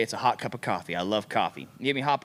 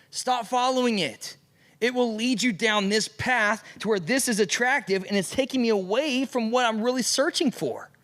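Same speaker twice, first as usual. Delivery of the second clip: fervent preaching; 5 semitones higher than usual. Acted. Pastor pleading with the youth to follow God, in fervent high preaching. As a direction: urge them to stop like a pastor at his peak.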